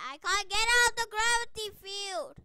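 loud, high exasperated complaint; frustrated yell. Real, not acted. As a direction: yell like you're trapped in the game. Trapped in game's gravity field.

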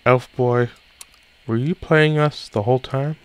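bright, quick, high-pitched character calling out; high bubbly girl voice. Acted. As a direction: call them over, high and fast. Same character voice again.

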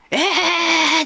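high, loud startled えー; a burst of retold astonishment; shocked cry. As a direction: yelp in sudden disbelief. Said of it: "びっくりするわけ".

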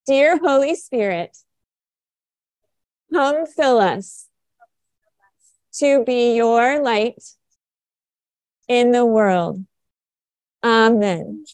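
slow phrases with long pauses; led prayer. Real, not acted. Leads children in prayer.

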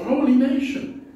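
usual speaking voice

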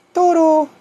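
very high falsetto call; cooing to pet. Real, not acted. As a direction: coo the cat's name in a squeaky voice. Calls his cat. (llamando al gato en falsete)

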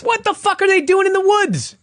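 very high, incredulous outburst; exasperated anger. Real, not acted. Podcaster echoing co-host's question about the woods, exasperated. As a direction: squawk it in baffled outrage. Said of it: "Why did this make me so mad?".

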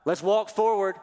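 high, loud go-time urging; urgent pep-talk God. Acted. God urging Moses to stop talking and move, loud and high. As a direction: push Moses forward like a coach. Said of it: "God's telling Moses".

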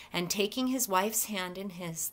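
usual speaking voice

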